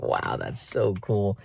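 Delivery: slow, drawn-out awe; savoring how cool it is; impressed wow. Retold or imitated. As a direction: marvel at it, stretching the words. Acted admiring reaction to hat.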